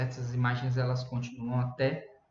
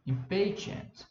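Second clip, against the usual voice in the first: impatient with PA raised; slow teacher's demo of impatient, stressed on PA; stress on second syllable; 6 semitones higher, slower than usual. Shown as a demonstration. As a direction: lift the voice on the PA of impatient. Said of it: "Você dá uma subida no".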